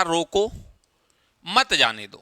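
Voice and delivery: रोको, then a clear break before a falling मत जाने दो; pause after रोको. Shown as a demonstration. Teacher shows how punctuation changes meaning, pausing after रोको. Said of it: "विराम चिन्हों का भी पता होना चाहिए".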